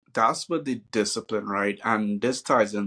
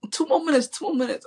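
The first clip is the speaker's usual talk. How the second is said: put-on much higher, pleading little-kid voice; whiny kid begging; faster than usual. Dad imitating his kid begging for more time, high whiny plea. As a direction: beg like a stalling child, pitched way up.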